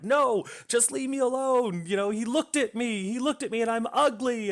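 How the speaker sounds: high-pitched, put-on distressed protest; upset young woman. Retold upset woman rebuffs old man.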